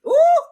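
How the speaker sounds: high loud ooh of delight; excited squeal. Real, not acted. Streamer seeing likes jump, high loud squeal. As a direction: squeal at the number.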